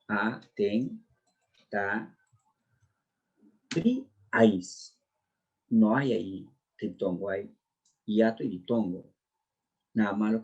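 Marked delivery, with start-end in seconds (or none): 0.1-5.0 "Atentaríais" broken slowly into separate syllables, long pauses between. Shown as a demonstration. Teacher showing students how the word divides, syllable by syllable. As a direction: draw out each syllable of "Atentaríais" on its own. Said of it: "sería de este modo".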